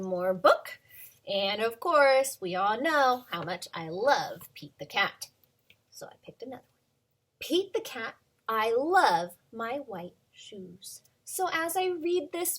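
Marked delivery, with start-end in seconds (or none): 5.9-6.7 whisper. Acted. Secret aside to kids.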